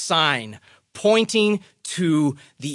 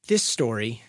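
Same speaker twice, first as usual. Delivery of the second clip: ordinary delivery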